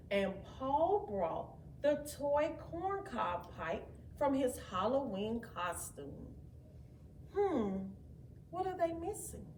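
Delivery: plain reading voice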